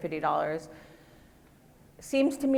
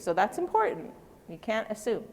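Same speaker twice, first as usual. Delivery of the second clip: ordinary delivery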